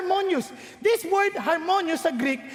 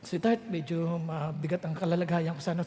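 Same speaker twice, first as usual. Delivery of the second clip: much lower and softer; weary spouse; 13 semitones lower, 7 dB quieter than usual. Retold or imitated. Spouse coming home telling partner of troubles, low. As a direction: sigh out a burden. Spouse shares heavy burden.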